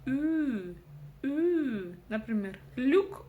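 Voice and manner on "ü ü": "Ü" held long and higher; loud demonstrated vowel "Ü", said twice; isolated Ü sound. Shown as a demonstration. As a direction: sustain a fronted "Ü", like Ю without its y. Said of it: "у нас остаётся звук".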